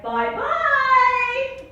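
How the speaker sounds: mock chirpy mother; sing-song bye. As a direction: sing the goodbye brightly. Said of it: "she's like".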